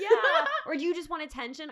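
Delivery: laughing. Real, not acted. Laughing through the question.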